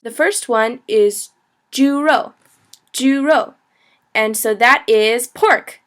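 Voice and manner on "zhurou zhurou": careful repeated "juro" for learners; pronunciation model. Shown as a demonstration. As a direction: say "juro" high on ju, dropping on ro.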